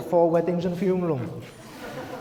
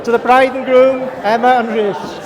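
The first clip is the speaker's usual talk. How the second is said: wedding toast speech; 7 semitones higher, 11 dB louder than usual. Real, not acted. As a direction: raise it to the whole room. Raising the toast to couple.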